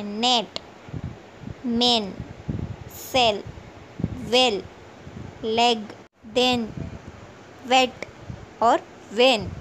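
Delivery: नेट through वेन, each word separate with an "eh" vowel. Shown as a demonstration. Teacher listing examples for English learners, नेट to वेन with E as "eh". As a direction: sound out नेट to वेन one by one, E said "eh". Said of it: "तो पहले ई का".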